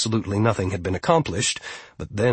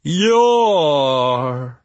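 loud, higher, long-held "Yor"; grand declamation; 4 semitones higher, 5 dB louder than usual. Acted. Grinda demonstrates the name to steward, loud and stretched. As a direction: draw yourself up and proclaim it.

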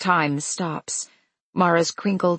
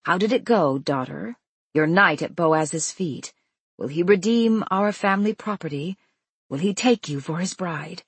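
ordinary delivery

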